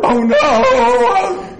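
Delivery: loud, very high drawn-out wail; mock despair. Fan despairing at the goal, screamed mock anguish. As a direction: scream it in hammed-up agony.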